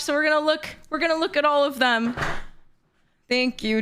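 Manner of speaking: ordinary talk, nothing marked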